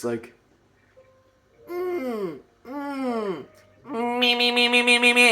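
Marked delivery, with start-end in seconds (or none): 1.7-5.3 high nasal me-me warm-up; nasal resonance. Shown as a demonstration. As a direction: sing it through the mask. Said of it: "nasal resonance".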